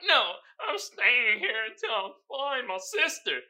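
high, slow defiant mock quote; drawn-out mock stubbornness; stubborn twin. Twin refusing the tour guide, slow defiant.

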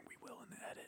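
very soft whisper. Real, not acted. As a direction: barely breathe the words. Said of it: "when I'm whispering".

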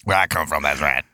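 very low "that's right"; low deep voice. Acted. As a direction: sink the voice low. Low witness voice.

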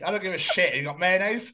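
ordinary talk, nothing marked

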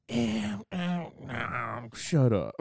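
high mimicking noises, then a dismissal; mock their hemming, then cut it off; imitated mocking stranger. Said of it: "one of 'em just goes".

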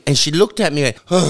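usual speaking voice

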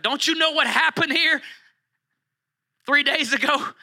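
high, pleading, frantic questions; distraught Mary. Acted. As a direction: cry out at a stranger who should know. Mary distraught at empty tomb.

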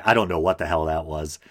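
normal talking voice

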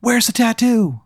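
high, slow demanding voice; thug demand. Acted. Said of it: "and they go".

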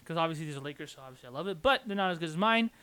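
ordinary talk

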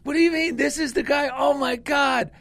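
high, astonished imitation; amazed wife. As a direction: disbelief rising into oh my God.

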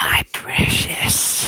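slow raspy whispered creature; Gollum voice. Retold or imitated. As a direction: hiss it out like a cave creature. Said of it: "Gollum voice".

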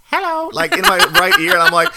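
very high intimate bird voice; parrot voice. Retold or imitated. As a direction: coo it like a flirty parrot.

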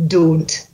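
"Don't" with a pure long O; Scottish "Don't", modelled for a learner; Scottish O sound. Shown as a demonstration. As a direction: say "Don't" with one unglided O, each evenly. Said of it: "That's it".

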